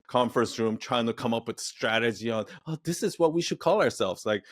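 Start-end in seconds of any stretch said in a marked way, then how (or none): 2.7-4.2 lightly put-on corporate exec; breezy, self-satisfied boardroom voice; mock executive voice. Mocking brand executives' strategy talk.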